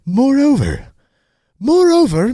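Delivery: high, loud sarcastic moreover; mocking moreover. Reading an article, mocking its fancy word.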